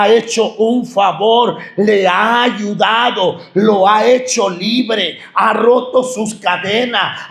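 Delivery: ordinary delivery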